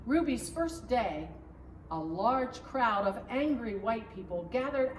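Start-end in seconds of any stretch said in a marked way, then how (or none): none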